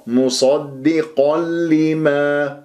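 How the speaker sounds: مصدق لما slowly, as "musaddiqal-limā"; doubled lām. Shown as a demonstration. Teacher shows this way, lām doubled without nasal. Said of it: "بهذه الطريقة جبنا اللام كأنه صارت مشددة".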